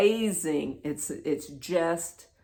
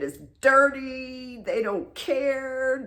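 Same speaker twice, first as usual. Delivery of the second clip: slow, high, drawn-out disgust; reliving indignant disgust; appalled; 8 semitones higher, 4 dB louder, slower than usual. Retold or imitated. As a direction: stretch the key words in dismay. Acted disgust at the planet.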